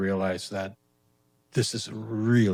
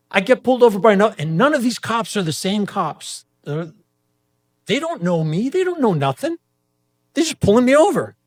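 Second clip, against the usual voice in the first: rising outraged rant; 11 semitones higher, 11 dB louder than usual. Real, not acted. Ranting about repeated ride stops.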